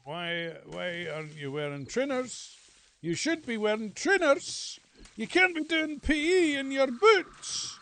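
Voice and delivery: high, loud nagging teacher voice; shrill up a scolding teacher; mock teacher nagging a pupil about his boots. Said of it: "the P E teacher would be like".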